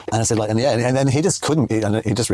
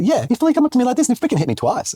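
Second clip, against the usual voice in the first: indignant retelling; 13 semitones higher than usual. Retold or imitated. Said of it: "I'm like".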